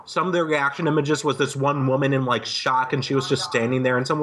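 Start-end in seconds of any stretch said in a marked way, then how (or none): none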